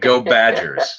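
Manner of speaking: drawn-out rallying cry for his team; slow team cheer. Real, not acted. Wisconsin boy correcting the host with a cheer. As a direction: chant it like a fan in the stands.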